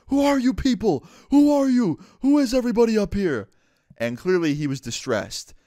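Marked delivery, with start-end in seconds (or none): 0.1-3.5 high, loud imitation of a screaming man; panicked screaming. Homeless man waking to strangers on the roof, imitated screaming. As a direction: yell it like someone waking confused among strangers. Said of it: "screaming at the top of his lungs".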